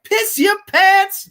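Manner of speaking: high, loud mock yell, drawn out; shouted movie quote. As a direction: imitate a thug yelling an order. Acting out the film villain's command.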